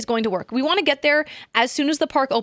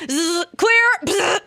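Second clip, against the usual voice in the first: mock defibrillator zap; 5 semitones higher, 3 dB louder, much slower than usual. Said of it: "They went".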